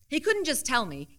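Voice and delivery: usual speaking voice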